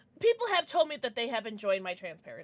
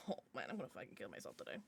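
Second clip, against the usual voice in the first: mock dark joke; 12 semitones lower, 16 dB quieter, much faster than usual. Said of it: "I'm joking".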